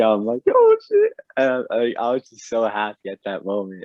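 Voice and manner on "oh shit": very high, loud mock scream; retold scream. Fan reliving the clutch alley-oop, high re-enacted scream. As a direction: re-enact a fan's shriek at a huge play. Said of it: "I just scream".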